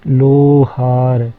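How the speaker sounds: लोहार said very slowly with a long o; teacher stretching लोहार; long o demo. Shown as a demonstration. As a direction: drag out the o in लोहार. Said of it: "ओ थोड़ा लंबा खिंच गया".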